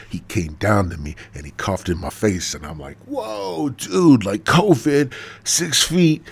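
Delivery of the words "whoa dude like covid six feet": high-pitched startled protest; mock alarm. Prophet to coughing God about covid, high mock alarm. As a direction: back off with a drawn-out high whoa.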